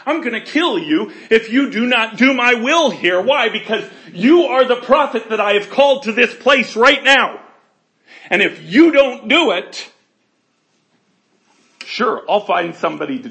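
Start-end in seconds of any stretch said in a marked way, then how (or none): 0.0-3.2 loud, commanding voice of God with a laugh; booming threat. Pretend. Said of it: "Do you understand what I'm saying?". 3.2-9.9 loud and higher, commanding; booming God voice. Pretend. God voiced demanding obedience.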